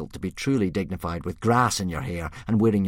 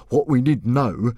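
slow, louder, higher chief voice; forced authority; 6 semitones higher, 8 dB louder, slower than usual. A narrator's reading. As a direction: bluff control you don't feel. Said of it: "trying to sound as if he was on top of the situation".

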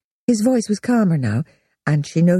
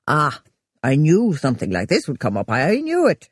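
triumphant. A narrator's reading. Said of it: "he said triumphantly".

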